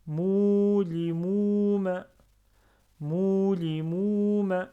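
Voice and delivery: Moulimouma read slowly, each long vowel held; prolonged vowels. Shown as a demonstration. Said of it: "Je prononce deux fois".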